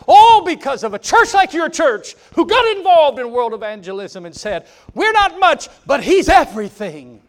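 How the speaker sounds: high, loud and driving preacher's call; full-force preaching. Acted. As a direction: preach it out to the back pews. Long preaching climb.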